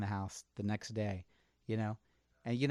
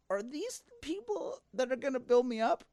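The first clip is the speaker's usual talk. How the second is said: high, airy mock voice asking himself; playfully high inner question; questioning self-talk. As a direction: pull back and wonder aloud in a lifted voice. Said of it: "I would probably take a step back and go".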